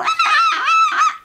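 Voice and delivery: piercing imitated kid wail; mock whiny shriek; high-pitched child's whine. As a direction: squeal very high like a whining child. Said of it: "when they go".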